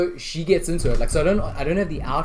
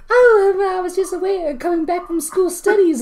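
very high put-on girlish voice, hesitant; mock a bad actress doing a little kid; squeaky little kid; 13 semitones higher than usual. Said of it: "weird, like, squeaky little kid voice".

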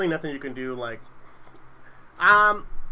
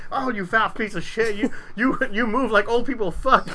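higher, slow, barked drill instructor abuse; drill instructor insult; 8 semitones higher, 4 dB louder than usual. Retold or imitated. Drill instructor berating a fat recruit, slow and barked. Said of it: "just the way, like, drill instructor Hartwell was doing".

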